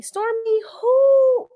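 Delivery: a loud, sky-high who, faking shock; mock disbelief. Real, not acted. Mocking a cast member's remark.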